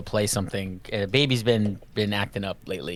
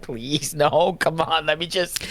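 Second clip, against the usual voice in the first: mildly desperate, raised-pitch plea; pleading; 4 semitones higher, slower than usual. Retold or imitated. Dad begging fussy baby mid-mission, pleading. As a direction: beg the baby to hold off.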